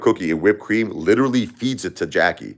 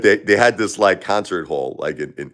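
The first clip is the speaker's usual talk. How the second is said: ordinary talk, nothing marked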